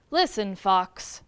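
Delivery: low, slow wolf voice; stern low wolf. A narrator's reading. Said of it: "the wolf said".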